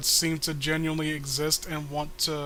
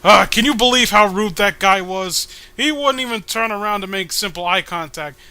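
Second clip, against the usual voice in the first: loud indignant complaint; 3 semitones higher, 8 dB louder than usual. Acted. Voicing comic character's complaint.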